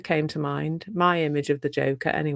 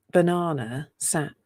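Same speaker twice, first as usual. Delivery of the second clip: ordinary delivery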